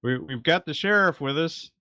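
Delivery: high, carrying announcement; calling out. Acted. Shane telling Howard about the sheriff, high call. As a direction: pitch it up to reach someone below.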